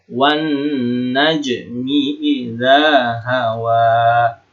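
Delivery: slow recitation, drawn-out n in "Wan najmi", long vowels in "idza hawa"; gunnah. Shown as a demonstration.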